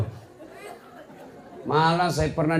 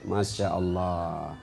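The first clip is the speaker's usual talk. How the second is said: ordinary talk, nothing marked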